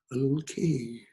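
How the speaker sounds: ordinary delivery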